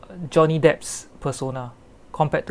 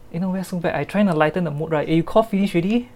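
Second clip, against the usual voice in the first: ordinary delivery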